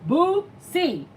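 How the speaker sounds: high, laughing repeat of Boo C; giggly real laughter. Real, not acted. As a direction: try out the new name and crack up. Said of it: "saying Boozy name wrong".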